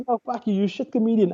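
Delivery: high-pitched retold abuse; mock heckler insult. Audience member insulting the comic, retold indignantly.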